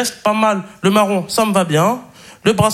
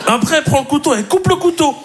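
high-pitched comic disbelief; mock amazement; 6 semitones higher than usual. Watching everything get cut into cake, mock amazement. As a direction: marvel in a raised voice, as if scandalised.

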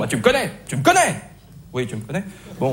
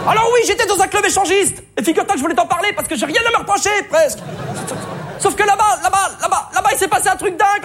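mock melodrama; 12 semitones higher than usual. Mock dramatic confession, high.

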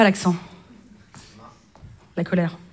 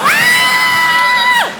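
long, very high held shriek; ear-splitting held scream; piercing scream; 3 dB louder than usual. Acted. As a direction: scream on one sustained top note. Babysitter act ends in scream.

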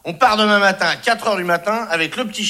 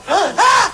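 mock ecstatic panting; 13 semitones higher, 5 dB louder than usual. Retelling excitement pant.

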